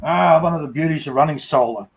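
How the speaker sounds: ordinary delivery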